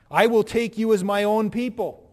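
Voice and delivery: solemn pulpit proclamation; proclaimed vow. Acted. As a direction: ring it out like a wedding vow. Quoting God's vow.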